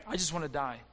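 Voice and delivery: a low, soft sigh of despair; a hint of a despairing prophet; despairing Elijah, low and soft. Preacher voices the despairing Elijah, low and soft. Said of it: "He was suicidal".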